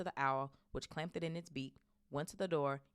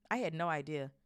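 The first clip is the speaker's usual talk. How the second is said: ordinary delivery, nothing marked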